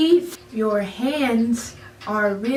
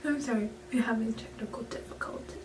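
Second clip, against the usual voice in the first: hushed quick aside; whisper; 3 semitones lower, 14 dB quieter, much faster than usual. Real, not acted. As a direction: whisper it fast, off mic. Aside during a recording mishap.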